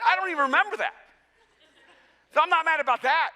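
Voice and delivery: defensive protest. Retold or imitated. Retold defensive protest to son.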